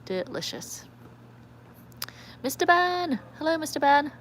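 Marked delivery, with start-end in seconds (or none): 2.4-4.1 high, called-out hello; excited greeting. Real, not acted. Greeting Mister Ben joining the live, excited high hello. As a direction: sing out the greeting in a high pitch. Greeting a viewer who joins.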